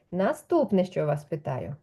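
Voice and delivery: ordinary talk, nothing marked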